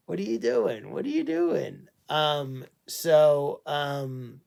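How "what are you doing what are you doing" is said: much higher, sing-song puzzled question; high teasing voice to a licking dog; playful pet voice. Real, not acted. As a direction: squeak it at a dog doing something odd.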